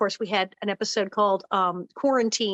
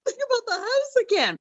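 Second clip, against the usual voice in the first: producers through laughter. Retold or imitated. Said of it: "And they said".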